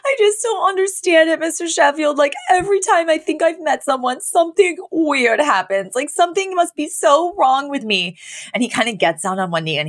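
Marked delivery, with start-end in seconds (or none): 0.0-8.2 high, whining nanny voice; whiny Fran. Retold or imitated. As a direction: pour out self-pity to the boss. Fran whines to Mister Sheffield.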